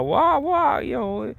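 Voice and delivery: very high, whiny mock baby talk; squeaky put-on baby voice. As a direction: talk like a little kid to mock. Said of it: "the baby talk".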